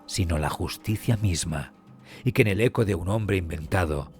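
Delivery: ordinary delivery, nothing marked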